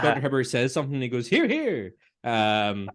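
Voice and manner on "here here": high, reedy agreement; quoting Mr Burns agreeing; mock Burns. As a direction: chime in like a frail old boss.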